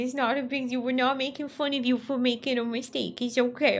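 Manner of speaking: put-on high, loud cooing baby talk; mock baby talk. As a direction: coo at them like a pouty toddler. Soothing a friend like baby.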